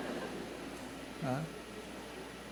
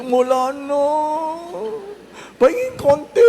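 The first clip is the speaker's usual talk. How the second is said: high, loud pleading for a bit more; begging guest; 8 semitones higher, 4 dB louder than usual. Retold or imitated. Guest who missed out begging a neighbour, high loud wheedling. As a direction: wheedle loudly for just a little.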